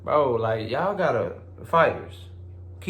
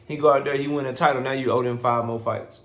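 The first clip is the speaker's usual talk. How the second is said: ordinary talk, nothing marked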